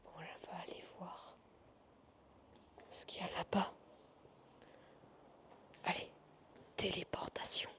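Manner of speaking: hushed exploring whisper; nervous night vlog whisper. Real, not acted. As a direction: whisper so nobody hears you. Exploring abandoned hotel, whispering.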